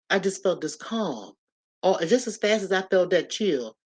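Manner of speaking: ordinary talk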